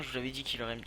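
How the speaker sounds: ordinary talk, nothing marked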